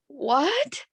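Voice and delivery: high, stunned disbelief; shocked what. Retold or imitated. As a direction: gasp it out in sudden shock. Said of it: "I instantly just in shock".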